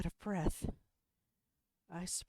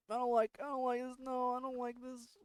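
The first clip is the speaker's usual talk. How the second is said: scared whine; 9 semitones higher than usual. Real, not acted. Scared by the closet.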